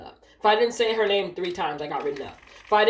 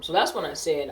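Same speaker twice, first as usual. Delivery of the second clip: normal talking voice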